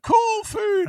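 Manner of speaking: very high, loud squeal; squeaky mock cheer. Cratchit family seeing the food, mock squeal of delight.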